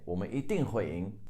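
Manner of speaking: ordinary talk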